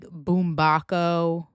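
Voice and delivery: Boombako slowly as boom-BAH-ko; mangled surname. Retold or imitated. Podcaster quotes people's mistakes, slow boom-BAH-ko. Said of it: "People have said".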